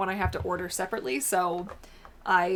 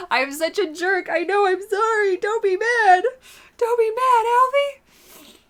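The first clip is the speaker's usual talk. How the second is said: pet apology; 13 semitones higher, 10 dB louder than usual. Real, not acted. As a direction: beg the dog's forgiveness, squeaky. Apologizing to the dog after laughing.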